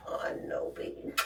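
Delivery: soft whispered comfort; whispered soothing to the dog. Real, not acted. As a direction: whisper it to the pet.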